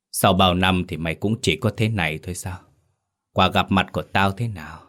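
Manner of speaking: plain reading voice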